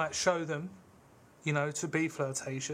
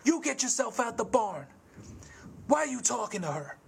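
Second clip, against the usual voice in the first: angry Curly; 7 semitones higher than usual. Retold or imitated. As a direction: snap at him like a jealous little tough. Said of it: "He's like".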